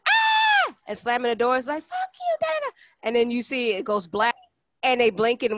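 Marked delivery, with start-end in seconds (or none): 0.1-0.7 put-on shrill high child's scream; piercing mock tantrum shriek; kid scream. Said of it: "the little kid screaming". 1.8-2.7 put-on high child's voice cursing; squeaky angry toddler; a much higher mock child; bratty kid yell. Kid yelling in TikTok.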